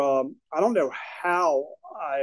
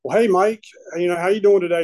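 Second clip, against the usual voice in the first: awkward cheery greeting; 3 semitones higher, 5 dB louder, faster than usual. Retold or imitated. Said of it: "I'd be like".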